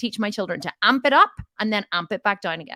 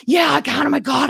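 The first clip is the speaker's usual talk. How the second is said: higher, louder mock fury; mock anger rising; 6 semitones higher, 7 dB louder than usual. Half-acted angry shout. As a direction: client shouting back to coach, mock angry.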